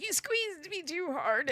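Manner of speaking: high, drawn-out mock whine; crying child. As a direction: imitate a kid wailing a complaint, slowly. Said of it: "He was crying. He was like".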